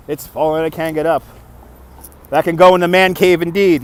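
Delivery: ordinary talk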